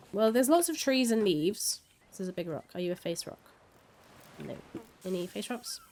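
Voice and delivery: ordinary talk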